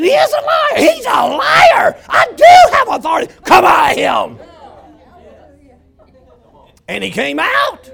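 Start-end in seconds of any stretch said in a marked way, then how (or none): 0.0-4.4 very loud, high rebuke of the devil; fiery preaching shout. Acted. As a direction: preach it at full force, commanding. Preacher rebuking devil at full force.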